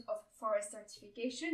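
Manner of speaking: imitating an English accent; self-mocking English accent. Shown as a demonstration. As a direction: say the name with an English accent, lightly hammed. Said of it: "avec mon magnifique accent anglais".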